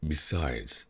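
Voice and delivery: usual speaking voice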